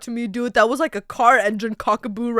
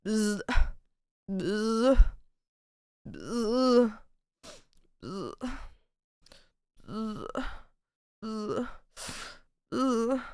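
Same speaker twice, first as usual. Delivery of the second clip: bee buzz; 3 semitones lower, 10 dB quieter, much slower than usual. Pretend. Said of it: "I can do a bee".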